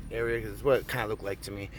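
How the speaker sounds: ordinary talk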